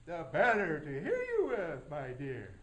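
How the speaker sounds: put-on low, sly wolf voice teasing the girl; big bad wolf. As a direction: growl it low like the wolf in bed. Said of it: "this wolf says".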